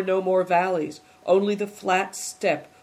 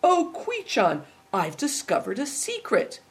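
excited; 7 semitones higher than usual. A narrator's reading. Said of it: "he cried suddenly".

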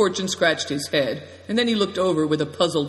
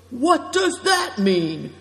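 high, slow indignant outburst; acted indignant cry; flaring up; 9 semitones higher, 4 dB louder, slower than usual. A narrator's reading. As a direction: flare up in offence, stretching the question high. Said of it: "flaring up".

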